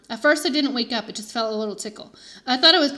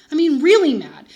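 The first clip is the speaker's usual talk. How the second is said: mad; 4 semitones higher, 10 dB louder than usual. A narrator's reading. Narrator emphasizing anger.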